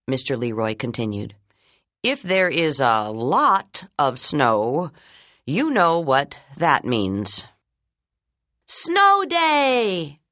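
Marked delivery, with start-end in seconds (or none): 2.0-7.5 slow teasing principal. Acted. Principal continues. 8.7-10.2 high, loud cheer; children calling out. Acted. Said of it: "the children all called out".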